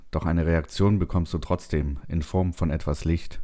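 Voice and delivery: usual speaking voice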